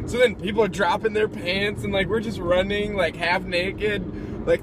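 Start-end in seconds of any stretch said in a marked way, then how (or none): none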